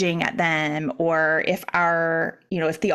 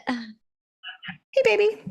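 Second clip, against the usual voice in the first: sweet parent voice; 13 semitones higher, much slower than usual. Real, not acted. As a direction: gently answer your little one. Answering her kid mid-interview.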